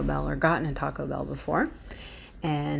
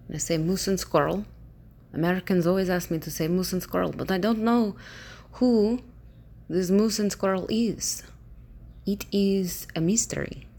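attempted Russian accent, rising into puzzlement; 2 semitones higher than usual. Shown as a demonstration. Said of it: "That was me trying".

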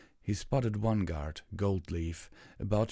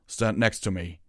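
raised, commanding call; shouted; 6 dB louder than usual. A narrator's reading. Mage rallies the guards to him, shouting. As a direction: shout to gather the others. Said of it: "Moontail shouted".

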